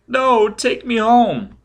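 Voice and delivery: put-on high, loud, slow child wail; shrieking child. A narrator's reading. Scared child begging to be taken home, wailing. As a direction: draw out the plea like a scared boy. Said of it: "The child was shrieking and shaking".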